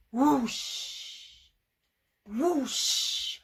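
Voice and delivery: long, airy swoop; owl whoosh. Shown as a demonstration. Owl whooshing overhead to watch, rushing sound. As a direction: whoosh like wings passing over. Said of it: "Owl is whooshing overhead".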